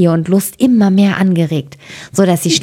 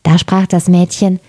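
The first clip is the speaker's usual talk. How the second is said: usual speaking voice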